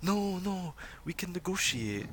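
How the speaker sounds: calming leader. Acted. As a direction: reach the crowd with a calming plea. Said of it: "tries to get her voice across the crowd".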